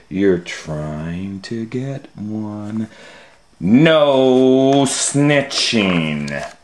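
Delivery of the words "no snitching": slow drawn-out no snitching; stretched warning to a child; stern scolding. Acted. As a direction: scold him slowly, drawing it out. Scolding David again.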